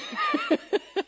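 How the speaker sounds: a high burst of laughter; bright, high cackle of a laugh. Real, not acted. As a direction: laugh at your own comeback. Laughs at her retort.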